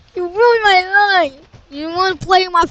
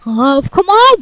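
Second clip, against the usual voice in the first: ordinary talk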